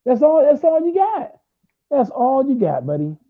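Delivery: high, mocking taunt. Real, not acted. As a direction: rub it in, jeering. Taunting rival fan in argument.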